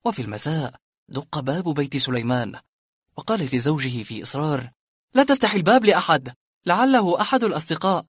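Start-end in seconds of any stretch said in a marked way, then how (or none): 5.1-8.0 wife's raised, urgent plea; wife urging husband not to open the door; worried wife. Acted. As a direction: hold him back from the door. Wife voiced in dialogue.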